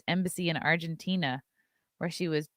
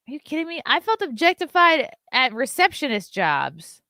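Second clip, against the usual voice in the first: incredulous outburst; 7 semitones higher, 6 dB louder than usual. Real, not acted.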